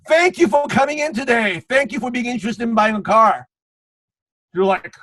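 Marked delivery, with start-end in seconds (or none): 0.1-3.5 over-eager salesman greeting, pitched up; mock car salesman. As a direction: gush like a dealership greeter. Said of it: "and the guy's like".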